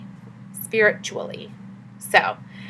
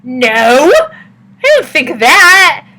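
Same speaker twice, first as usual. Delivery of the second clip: higher, louder, slow protesting no; defensive denial; 6 semitones higher, 8 dB louder, much slower than usual. Retold or imitated. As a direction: push back, flustered and insistent. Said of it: "And I was like".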